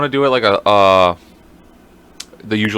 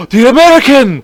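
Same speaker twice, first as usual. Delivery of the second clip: loud, high cry of discovery; hammed-up exclamation of shock; mock shocked realisation; 13 semitones higher, 10 dB louder than usual. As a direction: shout it as the penny drops. Acted sudden realisation after gasp.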